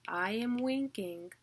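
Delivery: "I am winking" with "am" and "wink" hit harder; unstressed then stressed. Shown as a demonstration. Teacher gives students a mnemonic, stressing "am" and "wink". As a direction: say "I am winking at you" stressing "am" and the first syllable of "winking". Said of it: "the way you can remember it is".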